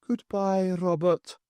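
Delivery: a faint, lilting ghost's farewell; ghostly farewell. Acted. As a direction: bid him a last goodbye. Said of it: "Morag's sing-song voice".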